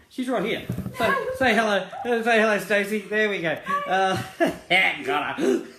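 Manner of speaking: high-pitched laughing. Real, not acted. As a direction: laugh through the words. Introducing Stacey, laughing.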